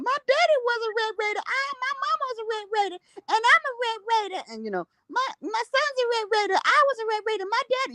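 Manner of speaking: high, fast mock voice of a proud local; lampooning the boastful townsfolk. Mocking town hall locals in a high put-on voice.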